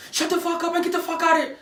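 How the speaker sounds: high-pitched mock cop order; hostile cop. Man imitating cop who confronted him, high hostile order. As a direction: imitate an aggressive officer ordering someone off. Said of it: "the first thing the cop said".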